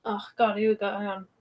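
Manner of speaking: ordinary delivery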